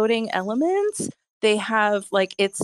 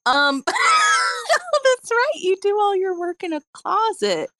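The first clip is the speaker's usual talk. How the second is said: laughing. Real, not acted. Laughs at closet joke.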